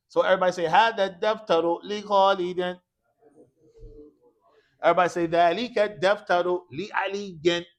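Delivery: loud classroom model for repetition; loud teacher leading a chant-back drill; calling out a drill. Shown as a demonstration. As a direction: project it for the class to repeat.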